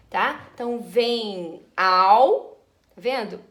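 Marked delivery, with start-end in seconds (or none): 0.8-2.6 "vem ao" slowly, the two vowels fused into one; elided vowels. Shown as a demonstration. Teacher showing students the elision, slow and fused. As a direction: glide "vem ao" together as one slow syllable. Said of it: "Vogal e vogal".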